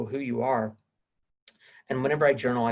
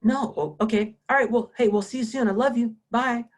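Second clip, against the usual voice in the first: high, loud mimicry of a wife's phone call; brisk, pitched-up imitation of a heated goodbye; imitated argumentative tone; 10 semitones higher, 5 dB louder than usual. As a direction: mimic her direct tone right through the sign-off. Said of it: "stayed at that tone".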